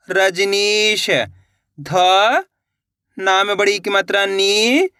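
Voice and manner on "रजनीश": slow blending. Shown as a demonstration. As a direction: draw the word out slowly, syllable by syllable. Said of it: "इसको इस तरीके से पढ़ लेना है". (तकलीफ को बहुत धीरे खींचकर बोलें)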